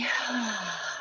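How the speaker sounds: long open-mouthed sigh, falling away softly; relaxed sigh. Shown as a demonstration. Meditation guide showing listeners how to release the breath, relaxed sigh. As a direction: breathe out in a soft, audible sigh. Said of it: "give a nice sigh".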